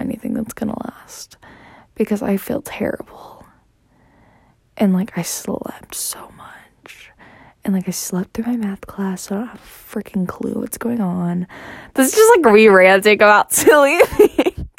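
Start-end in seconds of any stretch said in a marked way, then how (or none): 0.0-11.5 barely voiced, worn-out whisper. Real, not acted. Sick, whispering about feeling terrible.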